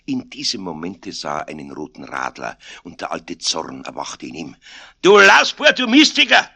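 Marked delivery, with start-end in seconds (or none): 5.0-6.5 high, very loud Bavarian scolding; angry shout. A narrator's reading. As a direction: yell the insult in a full-blown rage. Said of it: "schrie er". (zorniges Schreien)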